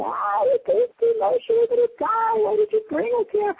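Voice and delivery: high, loud nagging whine at Moshe; whining complainers. Retold or imitated. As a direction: whine it like the grumbling crowd. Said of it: "they start whining".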